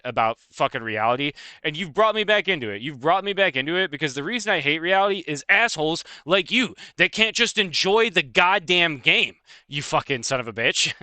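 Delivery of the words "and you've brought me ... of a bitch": worked-up, higher-pitched tirade; mock outrage, stretching out the insult; triggered rant. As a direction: build into an exasperated, sweary scolding. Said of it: "I could get really triggered at people".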